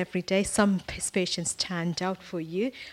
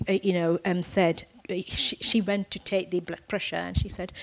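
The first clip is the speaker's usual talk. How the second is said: normal talking voice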